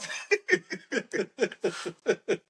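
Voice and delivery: long high-pitched laugh; real laughter. Real, not acted. Host cracking up over the Olympic medal count, laughing. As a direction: burst out laughing helplessly. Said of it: "Sorry, we're laughing".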